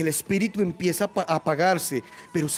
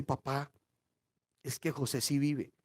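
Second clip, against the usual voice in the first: soft, lower-pitched admission; quiet, careful sons' voice; hushed confession; 5 semitones lower, 9 dB quieter than usual. Acted. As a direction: break the news gently to a father. Sons confess to their father.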